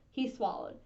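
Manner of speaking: plain reading voice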